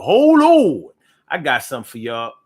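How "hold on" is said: excited call. Real, not acted. Stopping the stream excitedly.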